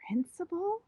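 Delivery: high breathless whispered gasp of shock. Acted. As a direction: gasp the word in alarm. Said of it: "very shocked".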